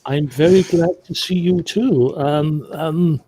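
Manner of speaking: awkward, stuttering politeness; mildly flustered stammer. Acted. Startled Briton hugged by manic friend.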